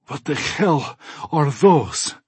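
slow, breathless, panting question; out of breath. Acted. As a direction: gasp it out after running.

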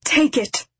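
sudden high outburst; defiant cry. A narrator's reading. Father tells X to take his soul, defiant cry. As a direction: throw it at him as a sharp demand.

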